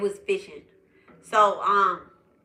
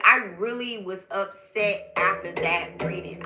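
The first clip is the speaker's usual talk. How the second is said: ordinary talk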